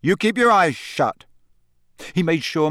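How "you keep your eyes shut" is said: higher, firm warning; stern boy. A narrator's reading. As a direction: order her sternly. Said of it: "said Harry darkly".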